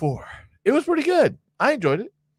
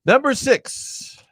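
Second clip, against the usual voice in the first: slow drawn-out "Number six"; announcer countdown; 9 semitones higher, much slower than usual. Acted. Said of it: "announcing list item".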